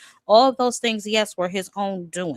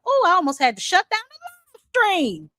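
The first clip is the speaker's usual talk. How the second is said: mock outrage; 12 semitones higher, 4 dB louder than usual. Mock outrage about rap claim.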